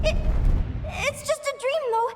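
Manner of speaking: high, shaky, stammering panic; panicked. Acted. Linnea trying to convince herself it is a dream, panicking. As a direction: talk yourself out of terror.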